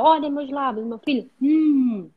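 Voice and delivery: put-on teaching a small child, ending in a long "hum"; showing a letter sound. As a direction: demonstrate slowly for your son, hold "hum" as mmm. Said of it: "eu tenho que falar".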